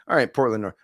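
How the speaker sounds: usual speaking voice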